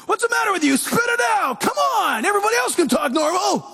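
high mock yell at a stuttering child; imitating a harsh father shouting; father's impatient yelling. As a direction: bark it like an impatient dad. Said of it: "he would say".

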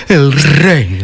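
very slow, swallowed drawl; Gloria Serra. Acted. Host echoes the presenter speaking backwards, slow drawl. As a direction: drag it out, talking backwards into the throat. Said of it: "A mí me gusta que habla para atrás".

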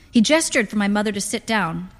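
usual speaking voice

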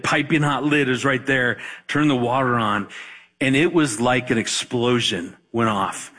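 usual speaking voice

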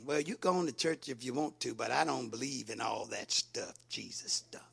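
low, quiet brush-off, trailing away; dismissive unbeliever. Acted. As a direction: play an unbeliever waving it off under the breath.

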